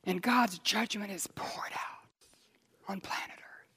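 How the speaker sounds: slow, solemn whisper; whispered preaching; hushed sermon whisper. Acted. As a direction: breathe the judgment out gravely.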